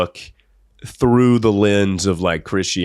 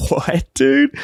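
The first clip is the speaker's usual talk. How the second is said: baffled what dude; 9 semitones higher than usual. Retold or imitated. Boy's baffled reaction.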